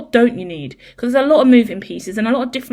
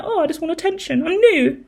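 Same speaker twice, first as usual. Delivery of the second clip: higher, pleading kitten voice; 8 semitones higher than usual. Retold or imitated. New kitten begging its owner for attention, higher voice. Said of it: "she's like".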